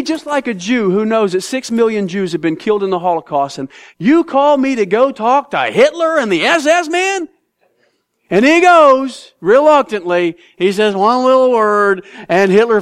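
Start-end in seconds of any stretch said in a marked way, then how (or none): none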